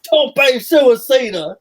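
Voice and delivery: loud, high, drawn-out move call; mock commentator yelling. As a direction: stretch it into a parody announcer's holler. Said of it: "Just an entire show of yelling".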